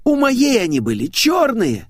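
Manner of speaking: put-on high girlish protest; distraught blonde. A narrator's reading. Blonde argues over the sticker's eyes, distraught. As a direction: protest it in a piping voice.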